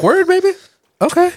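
eager. Retold or imitated. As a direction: perk up, high and keen. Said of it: "and then he's like".

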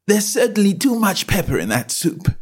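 put-on a well-mannered girl asking; young Alice. A narrator's reading. Alice to herself.

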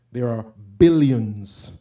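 ordinary delivery

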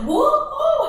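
high, loud joyful whoop; excited whoop. Retold or imitated. Reacting to the Holy Spirit's message, she whoops excitedly. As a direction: whoop it out like you just got great news. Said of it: "And I was like".

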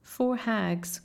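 plain reading voice

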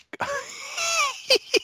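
high helpless laugh. Real, not acted. Laughing at the movie scene.

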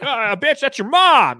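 high, loud hammed-up yell of a cussing dad; joking impression of a foul-mouthed dad; mock cussing dad. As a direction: bark it out like an angry father, overdone for a laugh. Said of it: "I'm just kidding".

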